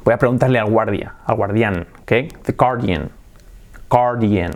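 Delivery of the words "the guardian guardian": guardian slowly modelled, GAR-dee-an; pronunciación correcta. Shown as a demonstration. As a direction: say "guardian" with no w sound. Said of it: "así sería". (guardian sin u, 'gár-dian')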